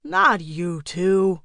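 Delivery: slow, drawn-out groaning protest; weary complaint, stretched long; exasperated. A narrator's reading. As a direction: drag the words out in exasperation. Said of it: "with an exasperated sigh".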